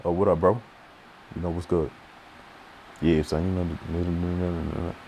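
low, soft, mumbled brush-off; mumbled whisper. Retold or imitated. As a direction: barely voice it, unbothered. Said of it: "you wanna whisper".

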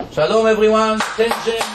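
loud, brash call for attention; brash loud greeting. Retold or imitated. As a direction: barge in and demand the room's attention loudly. Bursting into surgeons' convention.

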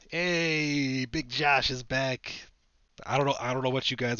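high, loud happy shout, then a rush; excited greeting. Real, not acted. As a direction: cheer for the card coming back. Excited to pull Josh.

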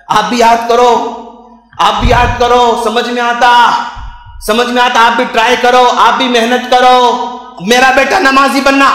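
impassioned preaching. Acted. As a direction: preach it forcefully to the congregation. Preaches, urging parents. (जोशीला उपदेश)

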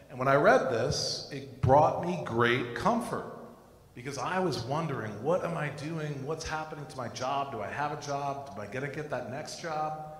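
normal talking voice

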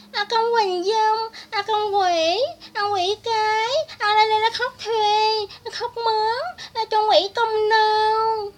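very high, loud, drawn-out fake keening; mock wailing. Speaker ridiculing a hired mourner, mock wailing. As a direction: wail like a hired mourner, hammed-up.

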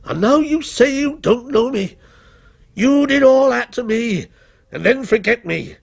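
high, mounting reproach; bitter accusation. A narrator's reading. Forgotten character confronts his author, bitter accusation. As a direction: hurl it as an injured accusation.

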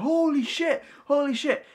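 high-pitched awe; freaking out. Retold or imitated. Kids watching the card tricks, freaking out in amazement. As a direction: imitate kids amazed out of their minds. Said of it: "they were freaking out".

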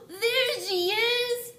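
very high, slow, crying out; a chick spotting his mother; excited baby bird. Acted. Baby bird sees the boat, high and thrilled. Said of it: "said the baby bird".

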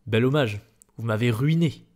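loud, indignant retort; bitter outrage. A narrator's reading. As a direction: fling the accusation back loudly. Acted quarrel with the director. (indigné, amer et fort)

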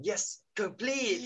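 complete slow and high, stress on the last syllable; drilled complete. Shown as a demonstration. Teacher leads students chanting complete with an action. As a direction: lead the chant slowly. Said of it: "Follow me".

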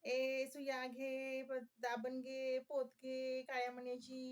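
drawn-out monotone vendor's call; imitated hawker's cry. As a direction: call out your wares on one held note. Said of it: "अशा बायका यायच्या ना ओरडत". (फेरीवालीची लांब सुरातली आरोळी)

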